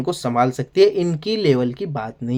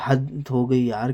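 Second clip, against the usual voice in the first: softer and slow, giving up; resigned, trailing off; 4 semitones lower, 6 dB quieter, slower than usual. Real, not acted. Reviewer giving up on Tanya's bragging, resigned and soft. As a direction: let it die away in weary disbelief. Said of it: "हंसी भी आ रही है और गुस्सा भी".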